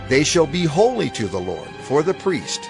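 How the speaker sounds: normal talking voice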